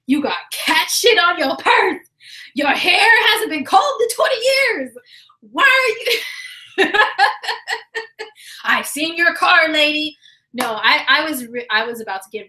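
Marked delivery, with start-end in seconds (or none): 0.1-6.9 petty yelling. Retold or imitated. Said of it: "this is my Virgo petty going on". 7.0-8.2 high cackle mid-rant; high laugh. Real, not acted. 8.6-10.2 petty yelling. Retold or imitated. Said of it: "this is my Virgo petty going on".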